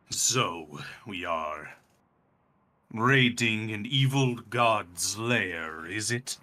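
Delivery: slow, drawn-out dragon speech; old dragon weighing each word; ancient dragon. Acted. Dragon questioning adventurers about their raid, slow and deliberate. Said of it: "and he goes".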